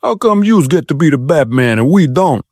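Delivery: put-on brash Sharks versus Jets New York accent. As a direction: thug asking Batman why he gets to be Batman, in a New York street accent. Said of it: "in a Sharks versus Jets accent".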